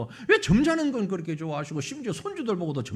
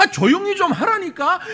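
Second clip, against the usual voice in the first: angry scolding; 7 semitones higher, 8 dB louder than usual. Acted. Crowd scolding louder.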